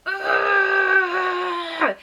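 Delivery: loud, high, drawn-out groan; high straining cry, acted out; pushing noise. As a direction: imitate a long labor wail while pushing. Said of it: "making this noise that I never even heard".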